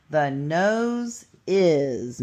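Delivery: slow word by word. Shown as a demonstration. Teacher reads sentence word by word.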